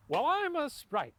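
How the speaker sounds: high, slow, lightly voiced sprite; slow, high-pitched announcement as a sprite; sprite impression. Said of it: "That's your impression of a sprite?".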